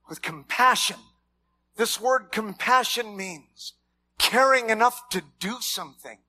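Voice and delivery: ordinary delivery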